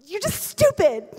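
high, loud mock-angry blurt; retold argument outburst. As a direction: blurt the insult as in a heated fight. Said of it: "in the heat of an argument".

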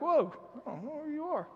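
high, startled yelp of a confused father; startled dad. Retold or imitated. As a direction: squeak it out in mock surprise. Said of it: "I'd be like".

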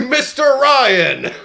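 high, slow mock bellow; hammed-up shout. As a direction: belt the name out, dragged. Mocking Richard Harris barging in.

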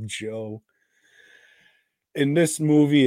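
usual speaking voice

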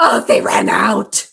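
slow, drawn-out imitation of a huffy complaint; mock tantrum. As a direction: stretch it out like an exaggerated sulk. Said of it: "she comes back without the coffee and she goes".

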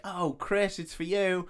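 high sing-out to her son; mum calling. Acted. Mum handing her son the phone, calling out high. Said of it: "And then she's like".

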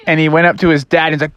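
ordinary delivery

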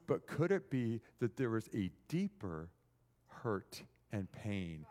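hushed, low, reflective preaching; tender, solemn musing. Real, not acted. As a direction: bring it right down, low and gentle.

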